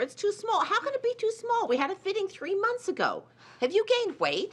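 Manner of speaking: high-pitched indignant complaint; exasperated, high and incredulous. Acted. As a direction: fret with rising disbelief. Mother exasperated at ill-fitting dress.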